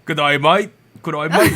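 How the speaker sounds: hammed-up Aussie greeting; lightly put-on Aussie voice, cheery; Australian accent. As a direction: lay on a mock Australian twang. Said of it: "with an Australian accent today".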